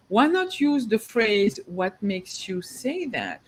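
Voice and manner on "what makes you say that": soft, slow, even question; calm, unemotional. Shown as a demonstration. Agent asking a client instead of disagreeing, calm and unemotional. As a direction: ask it gently and unhurriedly, without animation. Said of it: "without even becoming animated and emotional".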